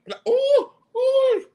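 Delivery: high squealing ooh of pleasure; delighted ooh. Real, not acted. To her viewers, craving fried plantain, a high delighted squeal. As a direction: swoon over the food.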